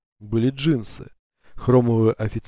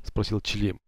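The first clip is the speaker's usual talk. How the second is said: ordinary delivery, nothing marked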